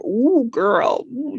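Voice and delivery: soft, knowing mock sigh; knowing ooh. Softly knowing reaction to someone from Detroit. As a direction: say it like you know the backstory. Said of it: "you're like".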